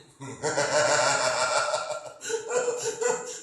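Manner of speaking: long, loud laugh climbing high. Real, not acted. Preacher amused by his counseling joke, loud laughing. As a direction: let out a long rolling laugh.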